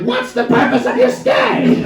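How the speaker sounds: interrogating officer as a bald eagle; squawking eagle cop. Retold or imitated. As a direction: drag it out like a screeching eagle. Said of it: "so he's like".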